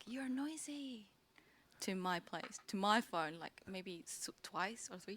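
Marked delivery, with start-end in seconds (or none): 0.1-1.1 hushed retold complaint. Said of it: "to say, like".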